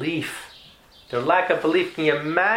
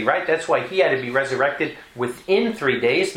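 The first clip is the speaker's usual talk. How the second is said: ordinary talk, nothing marked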